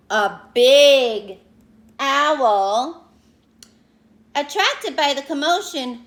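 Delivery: usual speaking voice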